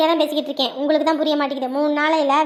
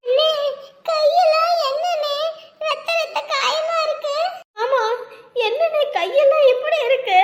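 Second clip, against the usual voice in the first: high, drawn-out worried cry; distressed concern; 9 semitones higher than usual. Acted. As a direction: fret over a brother's wounded hands in a high voice. Sister sees brother's injured hands. (பதறி, உயர்ந்த குரலில் இழுத்து)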